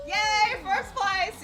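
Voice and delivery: high, loud victory cheer; excited cheer. Real, not acted. Streamer winning first place, high loud cheer. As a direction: celebrate the win.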